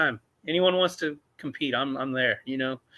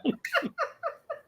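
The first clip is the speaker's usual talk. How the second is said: squeaky laugh; 8 dB quieter than usual. Real, not acted. Laughs at own joke.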